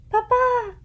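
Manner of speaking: high, loud call of Papa; a child calling Papa across the flat; excited loud cry; cried out. A narrator's reading. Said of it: "cried Anna".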